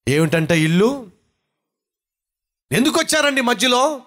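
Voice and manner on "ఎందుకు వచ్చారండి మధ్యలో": wife yelling. Retold or imitated. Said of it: "అరుస్తుంది గట్టిగా".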